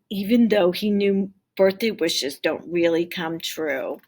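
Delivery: ordinary talk, nothing marked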